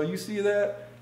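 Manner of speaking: high, mock Southern man's voice; Southern accent. Storyteller as the man boasting to onlookers, Southern accent. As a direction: imitate a Southerner showing off his girlfriend. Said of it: "they both had Southern accents".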